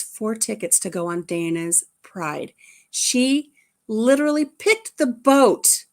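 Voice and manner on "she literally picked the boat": thrilled storyteller's amazement; amazed. Real, not acted. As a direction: marvel at the coincidence out loud. Amazed at the boat coincidence.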